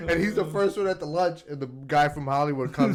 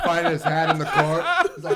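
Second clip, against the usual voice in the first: normal talking voice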